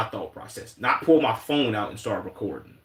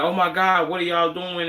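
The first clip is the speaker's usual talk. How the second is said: mock shocked onlooker; 6 semitones higher than usual. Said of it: "And like".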